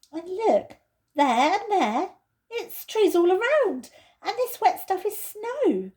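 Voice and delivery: Peter's voice, wondering. Acted. Peter realises they are in Lucy's wood, wondering. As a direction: let the discovery dawn.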